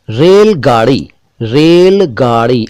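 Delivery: रेलगाड़ी slowly with a flapped ड़ in गाड़ी; slow careful model. Shown as a demonstration. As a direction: spell out रेलगाड़ी slowly, flick the ड़. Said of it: "कहेंगे तो गलत होगा".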